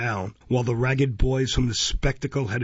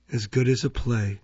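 mumbled chuckle. A narrator's reading. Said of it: "mumbled and chuckled".

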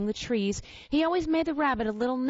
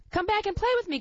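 high call to rabbits running off; lightly voiced high call to the departing rabbits; calling after them; 9 semitones higher, faster than usual. A narrator's reading. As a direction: call out across the bracken. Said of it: "called the little rabbit".

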